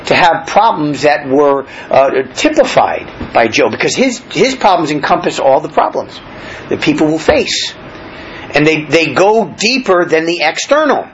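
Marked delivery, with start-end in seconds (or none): none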